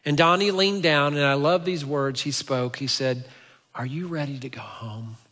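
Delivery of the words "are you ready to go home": soft, tender question; gentle and hushed. Retold or imitated. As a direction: ask it gently, almost whispering. Rescuer gently asks lost boy.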